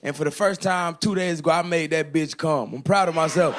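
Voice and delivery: ordinary delivery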